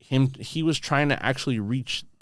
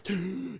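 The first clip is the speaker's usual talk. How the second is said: sudden waking gasp, high and quick; sharp gasp; 8 semitones higher, 8 dB quieter than usual. Shown as a demonstration. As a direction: gasp as if jolted awake. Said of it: "the first thing is".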